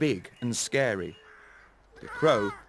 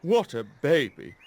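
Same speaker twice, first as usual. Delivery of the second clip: scornful crow; 3 semitones higher, 4 dB louder than usual. Acted. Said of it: "said the second crow".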